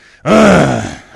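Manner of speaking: a loud shaky sob-sigh; heavy sob between words; tearful sob. Real, not acted. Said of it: "and I still cried".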